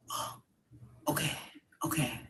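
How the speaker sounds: calming down. Retold or imitated. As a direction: talk yourself down quietly.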